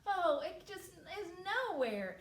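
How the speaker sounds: lightly voiced girl despairing; frustrated little girl. Girl gives up searching.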